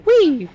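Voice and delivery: high squealed whee of joy; gleeful whee. Real, not acted. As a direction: squeal it as you jump in. Jumping down into the water.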